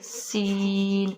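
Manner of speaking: سین drawn out slowly with a thin, whistling hiss; whistling seen. Shown as a demonstration. Teacher showing the whistling سین sound. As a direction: stretch سین into a fine whistle. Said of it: "سین کی آواز بھی باریک ... اور سیٹی والی ہوگی".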